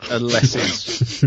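ordinary delivery

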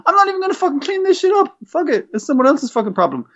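put-on high, defiant childish voice; a kid left home alone gloating; bratty kid voice. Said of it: "I'm eight years old".

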